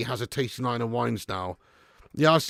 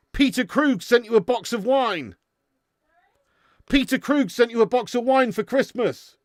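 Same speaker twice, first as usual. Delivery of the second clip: raised higher voice, calling through; 8 semitones higher, 4 dB louder than usual. Real, not acted. Man shouts to Missus P about a gifted box of wine, calling out. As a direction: call to someone in another room.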